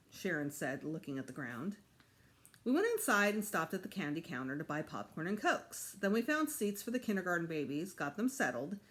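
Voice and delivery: ordinary delivery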